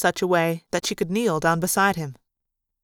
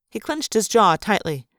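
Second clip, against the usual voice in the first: ordinary delivery, nothing marked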